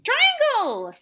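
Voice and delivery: shouting a name. Acted. Circle finds Triangle in dark.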